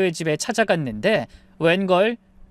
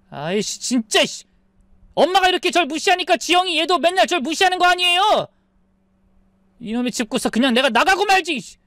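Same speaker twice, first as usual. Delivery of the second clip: angry outburst; 10 semitones higher than usual. Acted. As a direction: blow up and storm off. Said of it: "버럭 고함을 지르더니만". (버럭 소리치는 아들)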